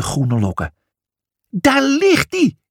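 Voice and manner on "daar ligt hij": high, slow mock exclamation; a storybook cry of discovery; exclaiming. A narrator's reading. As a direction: call it out, spotting the wig. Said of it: "riep hij uit".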